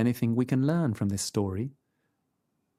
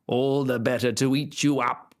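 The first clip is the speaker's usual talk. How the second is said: true wolf voice. A narrator's reading. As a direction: snap back to the real wolf. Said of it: "cried the wolf".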